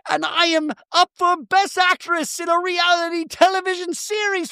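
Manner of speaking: high-pitched, hammed-up outrage; acted angry tirade. Bronwyn confronts Meredith in a monologue, hammed-up anger. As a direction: rant it out high, word by word.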